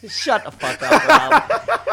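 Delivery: laughing, loud playful retort; laughing shout. Real, not acted. Teasing friend Rob, laughing loud shout.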